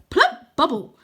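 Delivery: high, loud spell cry; boy wizard crying out a spell; cried spell. Acted. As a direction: call out the spell with gusto.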